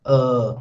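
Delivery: low, sustained "Uh"; long vowel. Shown as a demonstration. Teacher repeating the vowel for students, long low "Uh". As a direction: stretch "Uh" out, low. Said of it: "like this".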